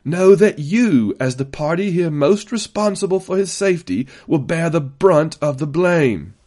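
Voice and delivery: loud, weighty public threat; barked warning. A narrator's reading. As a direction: bark the blame at him before everyone. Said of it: "he barked".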